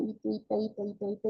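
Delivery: diminutive endings tossed off softly; soft, repeated baby-talk endings. Shown as a demonstration. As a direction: let the voice drop softly. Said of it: "esto hace que baje la entonación".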